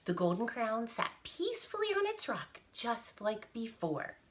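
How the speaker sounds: plain reading voice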